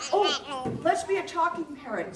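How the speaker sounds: usual speaking voice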